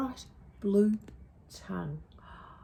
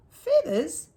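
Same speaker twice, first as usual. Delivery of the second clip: higher and louder startled question; surprised dingo; 9 semitones higher, 8 dB louder than usual. Acted. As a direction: bark it out in surprise.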